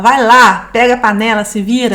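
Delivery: loud, curt mock snap; rude brush-off. Wife retelling her rude reply, loud mock snap. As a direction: bark it at him, half-acted. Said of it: "E ela fala".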